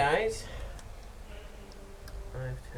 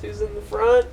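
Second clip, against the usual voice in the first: ordinary delivery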